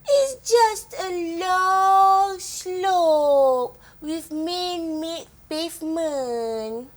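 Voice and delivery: very slow, stretched vowels sliding downward; drawn out. Acted. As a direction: draw out "long slope" like the slope itself. Showing how long the slope is.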